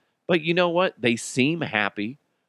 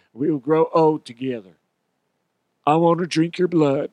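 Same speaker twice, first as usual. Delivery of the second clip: put-on dopey infatuated kid; 4 semitones higher, 3 dB louder than usual. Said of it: "dumbass kids that'll be like".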